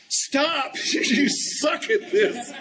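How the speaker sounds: high, drawn-out fed-up plea; imitating an exasperated wife, pitched well up; mock exasperation. Wife cutting off husband's clumsy pickup, mock exasperated.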